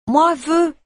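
put-on high, loud little-child demand; a toddler insisting he wants one; peremptory toddler. A narrator's reading. The toddler Ludovic demands a biscuit, peremptory and high. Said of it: "dit Ludovic d'un ton péremptoire".

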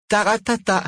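beaming high-pitched brush-off; gleeful dismissal. A narrator's reading. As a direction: wave it away, radiant with triumph. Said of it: "répondit Margot rayonnante".

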